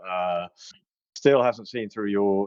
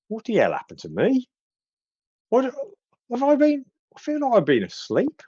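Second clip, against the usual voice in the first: confused Burley; 13 semitones higher than usual. Acted. Dazed Burley voiced in story.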